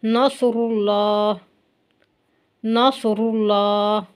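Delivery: नसरुल्लाह with heavy र and thick ल, slowly; slow tajweed recitation; heavy ra and lam. Shown as a demonstration. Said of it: "रा भी यहाँ पर मोटा हो रहा है".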